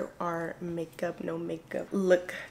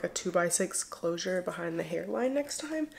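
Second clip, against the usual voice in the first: ordinary talk, nothing marked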